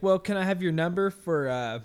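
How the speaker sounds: mocked cashier. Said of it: "and then she's like".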